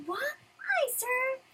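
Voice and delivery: very high flustered yelp; startled squeal. Acted. Lucy dragged off to work by Natsu, startled squeal. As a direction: squeak it out, caught off guard.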